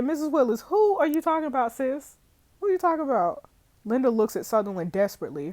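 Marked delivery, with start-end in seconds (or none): 0.0-3.4 urgent questioning. Acted. Detective pressing the grieving mother.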